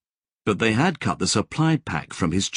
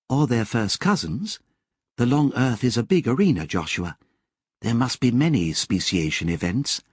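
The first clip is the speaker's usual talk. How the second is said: higher, measured android voice; a lightly higher, precise Lobsang; Lobsang's voice; 4 semitones higher than usual. A narrator's reading. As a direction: voice the calm, knowing AI.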